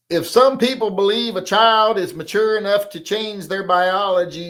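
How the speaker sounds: loud, high read-out voice; comment read-out. Acted. Host reading a viewer's comment aloud, loud high read-out voice.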